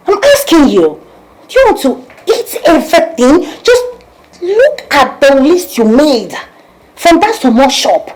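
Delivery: loud, high-pitched demand; angry scolding. Acted. Scolding a girl over her long shopping list, angrily. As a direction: raise your voice and take them to task.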